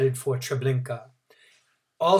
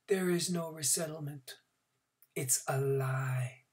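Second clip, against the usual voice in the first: whispered insistence; 3 semitones higher, 8 dB quieter than usual. A narrator's reading. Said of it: "The man repeated".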